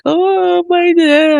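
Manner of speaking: high, drawn-out imitation of a child; mock kid reaction. Mimics kids when dad arrives.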